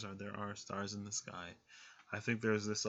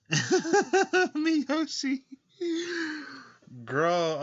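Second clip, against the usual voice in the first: high loud laughing call; laughing exclaim; 10 dB louder, much slower than usual. Real, not acted. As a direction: laugh it out at a friend.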